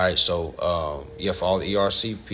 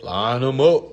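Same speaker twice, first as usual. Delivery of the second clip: louder hype call; rallying call; 2 semitones higher, 6 dB louder, slower than usual. Real, not acted. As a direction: fire up the crowd.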